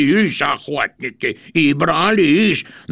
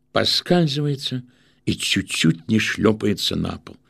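ordinary talk, nothing marked

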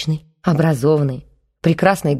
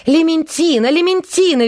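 shrieking; 12 semitones higher than usual. A narrator's reading. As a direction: shriek the dog's name at full pitch. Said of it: "верещала Белла".

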